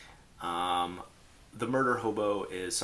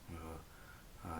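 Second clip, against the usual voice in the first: shy hesitation; 15 dB quieter than usual. Retold or imitated. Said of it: "look down at his paper and be like".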